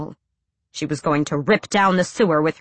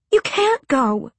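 pleading; 9 semitones higher, slower than usual. A narrator's reading. Pleading with him.

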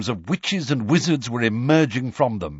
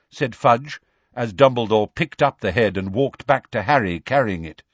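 plain reading voice

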